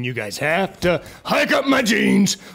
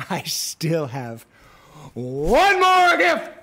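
slow villainous tease; 9 semitones higher, slower than usual. Acted. Villain gift, slow build.

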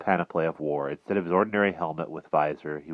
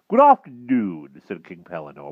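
put-on blustering old knight; 9 dB louder than usual. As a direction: bluster like a dotty old king. Pellinore voice.

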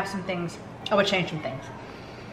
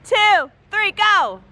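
high, loud countdown to jump; shouted countdown; 12 semitones higher, 5 dB louder, much slower than usual. Real, not acted. Countdown before jumping in.

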